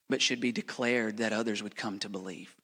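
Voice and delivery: normal talking voice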